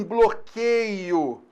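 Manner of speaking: "um bloqueio" stretched very slowly; drawn-out key term. Shown as a demonstration. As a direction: drag out "um bloqueio" for emphasis. Teacher stressing a key term.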